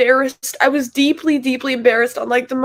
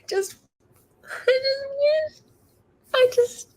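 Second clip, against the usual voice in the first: real sobbing, pitch jumped much higher; tearful, repeating through the sobs; crying; 8 semitones higher than usual. Real, not acted. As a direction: sob out the words, voice breaking. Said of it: "I'm so angry".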